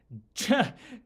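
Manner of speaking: a high, loud laugh; burst of laughter. Real, not acted. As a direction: crack up at the joke. Laughs at the Vaughan name.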